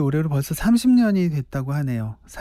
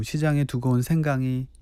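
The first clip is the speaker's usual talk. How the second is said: usual speaking voice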